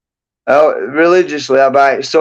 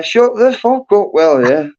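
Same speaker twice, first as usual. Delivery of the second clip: higher-pitched, drawn-out mock order to shut up; blunt telling-off; 7 semitones higher, slower than usual. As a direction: act out a blunt interruption. Voicing what listener should say.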